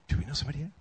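soft, confused murmur at a table; hushed table chatter. Retold or imitated. Diners wonder who sent the gift card, hushed murmuring. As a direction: imitate puzzled diners conferring quietly. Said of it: "that's re-, really what it sounded like".

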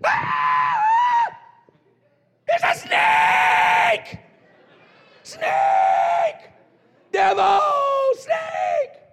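high, loud, slow hammed-up shrieks at a snake; comic terrified yelling; mock panic. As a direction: freak out like you've seen a snake. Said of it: "You know what we do?".

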